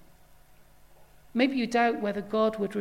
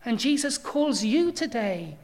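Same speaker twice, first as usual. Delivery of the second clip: ordinary talk, nothing marked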